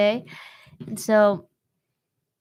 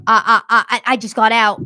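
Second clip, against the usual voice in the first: loud, stuttering mock panic; panicked stammer; 10 dB louder than usual. Attacker posing as escaped victim, loud panicked stammer. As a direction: stammer it out like a frantic fake victim.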